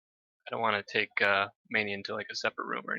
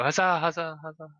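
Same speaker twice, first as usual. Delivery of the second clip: raised chorus of cheers; cheering chorus; 5 semitones higher than usual. Acted. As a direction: cheer like a crowd. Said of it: "they all go".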